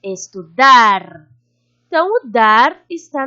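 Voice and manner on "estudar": estudar slowly, with a loud, low, long dar; lengthened final syllable. Shown as a demonstration. Teacher showing the tonic syllable is dar, stretching dar in estudar. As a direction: punch and hold dar in estudar. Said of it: "a sílaba tônica é dar".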